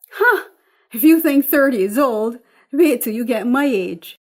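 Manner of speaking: loud, high scoffing huh then teasing; teasing mother. Acted. Mother teasing her daughter about turning thirty, loud and scoffing. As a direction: wave off her worry with a laugh in the voice.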